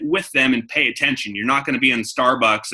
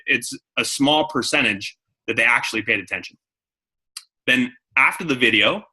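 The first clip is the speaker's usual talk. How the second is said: ordinary talk, nothing marked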